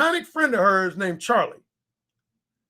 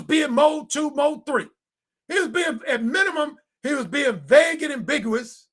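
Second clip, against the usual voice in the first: fired-up lecture; 6 semitones higher than usual. Acted. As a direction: drive the point home. Preaching the point to viewers.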